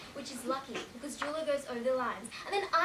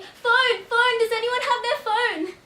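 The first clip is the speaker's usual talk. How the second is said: high, very loud desperate call; urgent shouting; 9 semitones higher, 15 dB louder, slower than usual. Acted. Crash survivor outside the car calling for a phone, urgent shout. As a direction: shout out for help, pitched way up.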